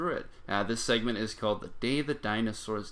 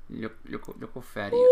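overwhelmed by cuteness; 8 dB louder than usual. Real, not acted. Showing a fat lizard.

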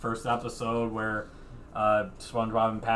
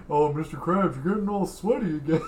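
high cartoon voice; 6 semitones higher, 5 dB louder than usual. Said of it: "quoting character".

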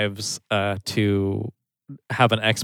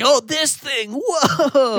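much higher, giddy and amazed; excited; much slower than usual. Retold or imitated. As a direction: play him as wildly hyped. Voicing the hyped military guy.

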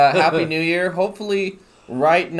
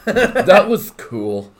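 laughing; 6 semitones higher, 6 dB louder, much slower than usual. Real, not acted. Repeating line with laugh.

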